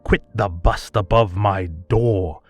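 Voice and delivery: ordinary delivery, nothing marked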